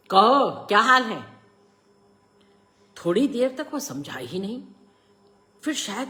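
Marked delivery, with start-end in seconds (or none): 0.1-1.4 high, loud challenge; loud rousing. A narrator's reading. Father rousing his son in the locked room, loud challenge. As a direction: call out sharply to someone just woken.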